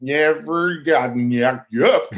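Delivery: slow, drawn-out mock Russian accent. Actor fondly mimicking Russian costar, slow Russian accent. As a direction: savor the garbled line in a Russian accent. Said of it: "one of my favorite things ever".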